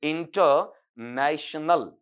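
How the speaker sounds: इंटरनेशनल very slowly, broken after इंटर; slow pronunciation demo. Shown as a demonstration. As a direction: draw out इंटरनेशनल part by part for learners. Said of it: "मैं बोल जरूर दूंगा".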